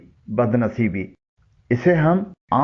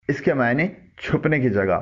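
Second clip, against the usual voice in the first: ordinary delivery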